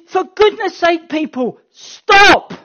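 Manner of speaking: high, loud, crying plea to stop; a loud, near-crying cry of "stop!"; passionate plea. Acted. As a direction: beg them with passion to stop. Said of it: "that kind of passion behind it".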